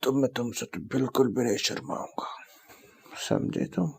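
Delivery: whispered, intimate declaration; teasing whisper. Acted. Boyfriend tells girlfriend he won't be shy, teasing whisper. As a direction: whisper it close to your partner.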